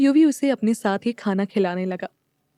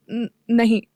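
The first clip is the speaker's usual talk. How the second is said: stammering. A narrator's reading. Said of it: "Vishu हकलाते हुए बोली".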